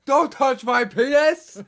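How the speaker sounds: high, drawn-out theatrical shout; hammed-up yell. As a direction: bellow it in mock outrage. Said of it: "he yells".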